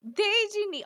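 high, loud stammered cartoon protest; mock panic of a flustered duck; Donald. Podcaster voicing Donald's protest in Japanese, mock panic. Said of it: "And then he's like".

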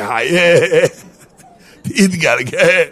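hammed-up yelling with a laugh; wife's yell. Wife screaming at husband, comically drawn-out yell. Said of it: "היא צועקת".

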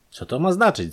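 high-pitched, worried exclamation; alarmed call. A narrator's reading. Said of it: "zawołała Maria zaniepokojona".

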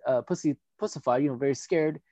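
normal talking voice

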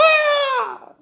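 long, very high holler; pained holler. A narrator's reading. Husband hit on his feet again, hollering in pain. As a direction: cry out high as the board hits. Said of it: "He hollered".

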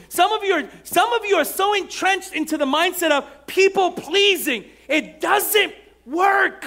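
loud, high, driving sermon climax; full-force preaching. Acted. Pastor pressing the congregation, shouting high and strong.

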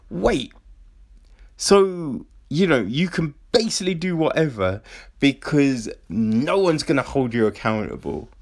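ordinary talk, nothing marked

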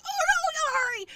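high, rapid fluster; frantic hurry. Retold or imitated. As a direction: squeal it out in a rush. Acts rushing late to school.

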